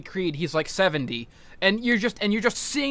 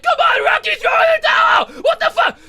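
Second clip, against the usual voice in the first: much higher mock woman's voice, louder; shrieking wife urging him to quit; wife's voice; 7 dB louder than usual. Wife voiced in retold scene.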